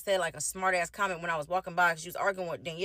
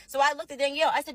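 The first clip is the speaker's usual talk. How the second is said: ordinary talk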